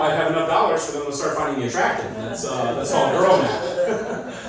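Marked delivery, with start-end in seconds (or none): 2.7-4.4 slow punchline. Shown as a demonstration. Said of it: "punchline said twice".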